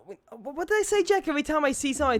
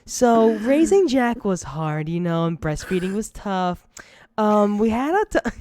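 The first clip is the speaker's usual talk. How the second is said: louder, drawn-out mom on a podcast; friend's mom impression; 5 dB louder than usual. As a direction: play someone's mom recounting parenthood. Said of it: "that's the thing Jack's mom would be".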